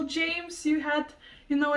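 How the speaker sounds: ordinary talk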